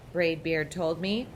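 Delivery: usual speaking voice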